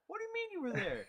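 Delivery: high-pitched retold disbelief; acting out shocked incredulity, pitch way up; mock incredulous. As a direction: blurt it as a stunned question. Retells shock at granddad's near miss.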